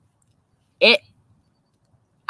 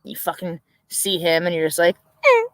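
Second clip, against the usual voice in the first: usual speaking voice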